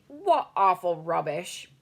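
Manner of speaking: a lightly voiced, slow scoffing queen; a hint of a dismissive queen; mocking Queen. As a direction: giant imitating the Queen's scoff. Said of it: "The Queen will be laughing and saying".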